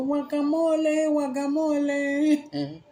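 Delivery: high, slow, drawn-out vendor cry; stretched high hawking shout; vendor punchline call. Retold or imitated. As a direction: sell the smashed avocados like a market boy. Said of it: "he goes".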